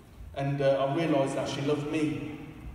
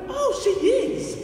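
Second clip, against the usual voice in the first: high, loud and drawn out, slowly; surprised realisation; 7 dB louder, slower than usual. Retold or imitated. Preacher recalls realising his wife loves him, high surprised cry. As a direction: let it dawn on you in a burst. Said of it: "I went".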